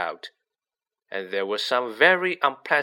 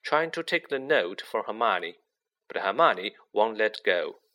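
ordinary delivery, nothing marked

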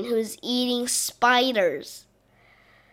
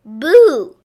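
loud, high ghostly boo; 4 semitones higher, 11 dB louder than usual. Acted. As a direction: jump out and scare them. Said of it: "says the ghost".

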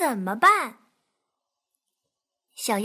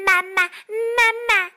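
duckling calling; 7 semitones higher, 5 dB louder than usual. Acted.

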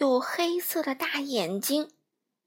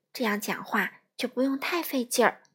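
plain reading voice

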